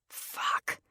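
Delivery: breathy unvoiced curse under the breath; whispered curse. A narrator's reading. Narrator cursing privately, a whispered fuck. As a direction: swear it silently to herself.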